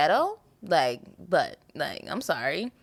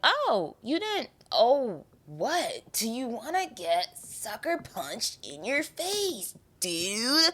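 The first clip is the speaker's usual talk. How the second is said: slow, higher put-on voice, daring someone; mock tough-girl threat; 7 semitones higher, much slower than usual. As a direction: taunt them in a higher, slowed tough voice. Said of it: "people meet me and it's like".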